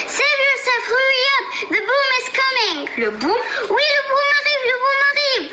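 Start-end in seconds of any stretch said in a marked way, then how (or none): 0.1-2.8 high panicked yell; shouting. Acted. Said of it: "en s'écriant". 3.7-5.5 shouting. Acted. Animals shout warning.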